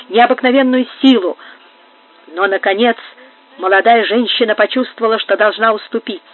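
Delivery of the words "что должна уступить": plain reading voice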